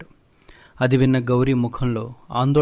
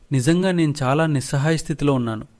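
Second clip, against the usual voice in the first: ordinary delivery, nothing marked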